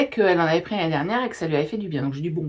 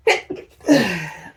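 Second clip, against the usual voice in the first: loud real sneeze; 5 dB louder than usual. Real, not acted. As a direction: let the sneeze burst out. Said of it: "je vais éternuer".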